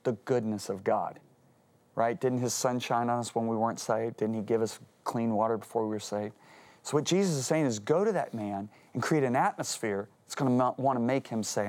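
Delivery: ordinary talk, nothing marked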